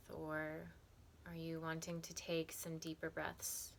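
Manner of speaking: calm, softened meditation voice with long pauses; unhurried, even, soothing meditation guide; guided meditation. Acted. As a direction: guide them gently through breath and body, leaving space between phrases.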